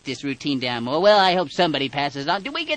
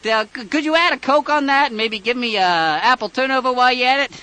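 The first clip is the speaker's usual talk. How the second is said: high, loud overeager caller voice; excited prank caller; 9 semitones higher, 5 dB louder than usual. Acted. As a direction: insist you're old friends, loudly. Caller persona ordering fast food.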